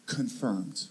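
low, flat, very soft one-word reply; deadpan. Retold or imitated. Bored clerk answering the angry guest, deadpan and low. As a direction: drop it dead and quiet like a bored clerk. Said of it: "He's like".

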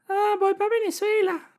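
very high, squeaky, jittery voice; nervous squeaky self. Retold or imitated. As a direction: squeak it out like a scared traveler. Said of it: "pero me pongo nervioso".

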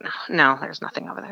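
whisper. Retold or imitated. Guide dismissing her in hiding.

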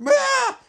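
sudden high loud yelp of shock; cartoonish startled scream; mock scream. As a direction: yelp like you've been jolted. [name] reacts in comic recap.

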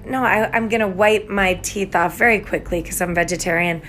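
ordinary delivery

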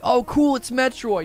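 high, eager "oh cool"; mock excited gamer. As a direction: play the thrilled newcomer. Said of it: "And you're like".

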